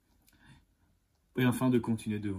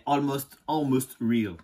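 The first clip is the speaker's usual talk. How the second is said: mock English accent on Almost Real, lightly done; brand name said with a playful English accent; 3 semitones higher, 4 dB louder, much slower than usual. Shown as a demonstration. Vlogger showing off his English accent on a brand name. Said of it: "Mon accent est parfait, je sais".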